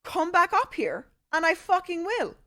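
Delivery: retold challenge. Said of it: "I was like".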